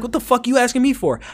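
high-pitched indignant question; retold irritated comeback. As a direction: imitate an annoyed brother throwing it back. Brother snapping at him, retold.

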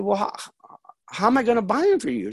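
re-enacted high, quick protest; baffled son. Son asks mom how to buy for her, baffled. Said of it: "And I said".

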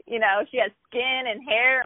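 ordinary delivery

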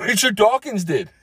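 high-pitched mock disbelief; acted astonishment; incredulous. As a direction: echo it high, falling away in disbelief.

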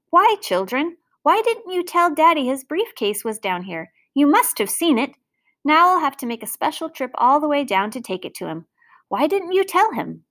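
exasperated parent demanding an explanation; exasperated scolding. Acted. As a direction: scold the children in dismayed questions. Mother scolds children over briefcase.

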